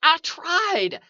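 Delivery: high, loud, desperate protest; panicked protest. A narrator's reading. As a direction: burst out in panic. Trapped man protests in panic.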